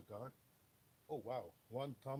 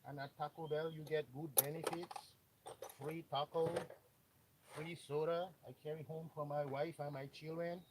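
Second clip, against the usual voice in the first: hammed-up broken-English Mexican accent, pitched higher; playful fake Mexican accent, speaking higher; mock Mexican accent; 3 semitones higher, 3 dB louder than usual. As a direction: put on a comic Mexican immigrant accent. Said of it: "Me no speak a English".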